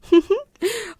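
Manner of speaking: short smug laugh; smug little chuckle; proud laugh. Acted. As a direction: laugh at her, pleased with herself.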